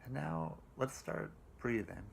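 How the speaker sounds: slow, calm meditation voice with long pauses; guided meditation. Acted.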